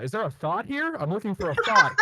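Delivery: high, loud imitation German accent; thick German accent. Pizza guy calling for Thor over the mic, mock German accent. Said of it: "in a very thick German accent".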